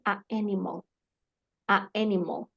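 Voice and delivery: "a animal" with a small gap after "a"; pausa entre vocales. Shown as a demonstration. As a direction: separate the two vowels in "a animal". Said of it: "van a hacer una pausa". ("a animal" cortado, con pausa tras la a)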